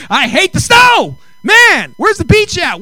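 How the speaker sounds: loud, high mock rant; angry griping. Pastor re-enacting sulking at snow camp, loud angry rant. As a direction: grumble furiously about the snow. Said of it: "sitting there going angry".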